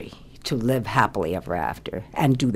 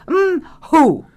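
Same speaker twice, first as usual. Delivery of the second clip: high, loud "mm, who" sob sound; demonstrated mock sob; 14 semitones higher, 7 dB louder than usual. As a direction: show her how a comic cry goes. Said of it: "now you go".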